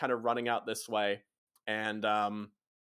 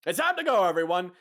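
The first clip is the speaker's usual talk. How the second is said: loud, high holler; rally the whole crew loudly; calling out; 4 semitones higher, 8 dB louder than usual. Acted. Boss calling everyone to leave, hollered.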